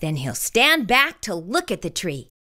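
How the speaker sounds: usual speaking voice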